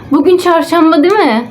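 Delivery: high excited gasp of realization; light up with a sudden thought. Real, not acted. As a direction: gasp then chirp with joy.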